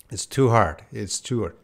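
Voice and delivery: ordinary delivery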